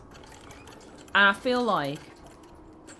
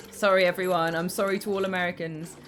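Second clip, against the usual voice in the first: normal talking voice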